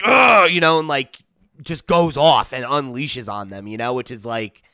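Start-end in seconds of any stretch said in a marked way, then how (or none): 0.0-0.5 loud strained grunt; mock roar of effort. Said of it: "he's like".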